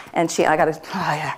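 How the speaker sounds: real tears catching, voice sinking low; choking up. Real, not acted. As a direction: break off as the tears come. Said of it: "I'll probably cry myself".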